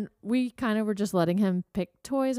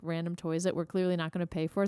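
ordinary talk, nothing marked

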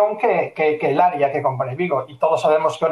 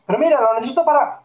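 higher, eager client nagging; pushy client voice; 7 semitones higher, faster than usual. Retold or imitated. Retelling a client's message, higher and insistent. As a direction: play the needy client pestering.

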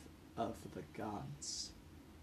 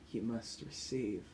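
ordinary delivery

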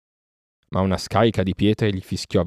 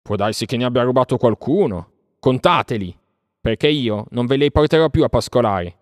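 raised, louder defiant farewell; scornful shout; 6 semitones higher, 5 dB louder than usual. A narrator's reading. Mowgli leaving the sons of men, shouting scornfully. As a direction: call out loudly with contempt, higher. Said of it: "gridò Mowgli sprezzantemente".

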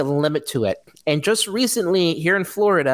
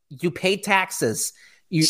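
ordinary talk

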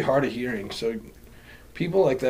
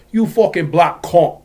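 put-on slow, louder British-accent insult; mock British accent; 2 semitones higher, 7 dB louder, slower than usual. Imitating those guys in the UK, exaggerated British accent. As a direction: put on a drawn-out UK accent, loud. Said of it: "those guys in the U K".

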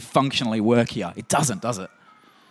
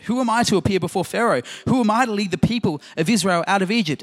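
higher, pleading objection; protesting Moses; 8 semitones higher than usual. Retold or imitated. Preacher voicing Moses objecting to God, higher and pleading. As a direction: Moses pushes back, pitched up and pleading. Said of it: "He protested".

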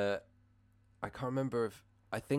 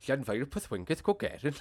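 exaggerated sing-song Welsh accent; 3 semitones higher than usual. Shown as a demonstration. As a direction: overdo the Welsh accent melody. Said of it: "too much of a stereotypical Welsh accent".

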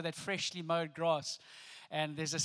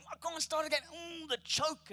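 mimicking the advert; 9 semitones higher than usual. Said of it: "And I'd go, like".

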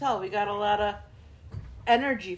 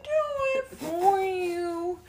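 slow, high, whimpering dog voice; high whiny dog voice; 13 semitones higher, slower than usual. Pretend. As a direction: whine it like a begging dog. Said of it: "And I'm like".

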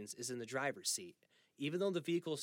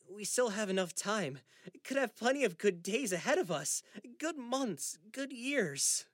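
pleading; 8 semitones higher, 5 dB louder than usual. Acted. Son pleading for more time.

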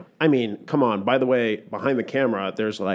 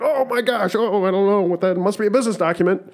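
flustered; 10 semitones higher than usual. Pretend. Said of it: "you know, like".

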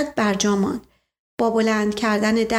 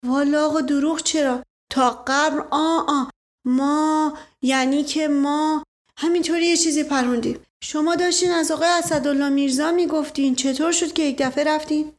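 higher-pitched, stammering manservant; raised voice of a servant fumbling for excuses; flustered servant; 5 semitones higher than usual. Acted. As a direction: backpedal nervously under interrogation. Narrator voices Mash Qasem.

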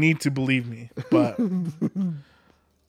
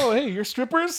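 high, put-on casual surprise; mock casual; 10 semitones higher than usual. Friend acts out casually running into strippers, mock casual. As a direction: play at stumbling on someone by chance. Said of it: "you just casually, like".

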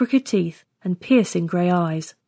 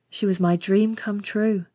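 ordinary delivery

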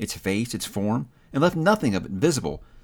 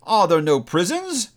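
higher, slow, biting echo; spirit mocking; 7 semitones higher, 4 dB louder, slower than usual. A narrator's reading. Spirit throwing Scrooge's words back, slow and biting. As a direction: throw his own words back, slowly. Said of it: "said the spirit, turning on him".

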